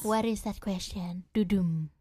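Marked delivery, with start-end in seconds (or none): 1.3-1.9 whispered dun-dun; whispered drumroll. Real, not acted. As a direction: breathe it like suspense. Dramatic sound effect whispered.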